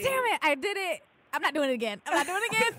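high, frustrated mutter to self; exasperated inner voice. Retold or imitated. Mock regret after taking chance.

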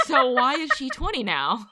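loud laughing question. Real, not acted. Laughs in disbelief.

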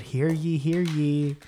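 loud herald call; town crier. Pretend. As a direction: proclaim it like a crier. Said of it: "I do think he would said".

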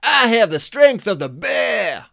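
loud, high, slow dude bellow; Billy bursting from the toilets, shouting; shouted. Acted.

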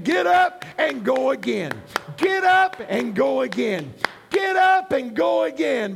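rally the fallen, pushing harder each time; rousing exhortation. Acted. Preacher voices Christ urging believers on.

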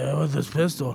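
lightly slurred drunk imitation; imitated drunk customer. Drunk customer buying a gun, lightly imitated slurring. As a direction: mumble it like a drunk buyer. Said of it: "could barely say stuff".

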